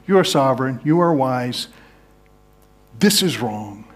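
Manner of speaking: honest lament building to a plea; lament prayer. Shown as a demonstration. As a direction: plead honestly with God, building. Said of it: "It's like saying".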